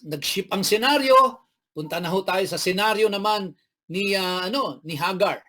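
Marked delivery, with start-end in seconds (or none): none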